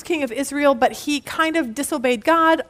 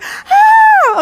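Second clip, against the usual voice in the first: imitated scream; 10 dB louder than usual. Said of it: "this little girl's just like".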